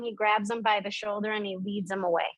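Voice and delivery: ordinary talk, nothing marked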